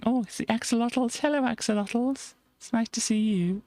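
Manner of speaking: cute high voice greeting the axolotls; excited greeting. Acted. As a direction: squeal a delighted hello.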